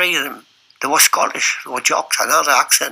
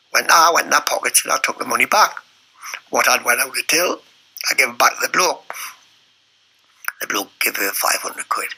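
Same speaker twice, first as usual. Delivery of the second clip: ordinary talk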